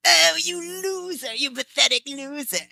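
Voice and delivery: high, loud, slow Frieza-style taunt; gloating villain voice. Retold or imitated. To her co-star who lost the race, a gloating villain taunt. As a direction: sneer down at the loser who lost.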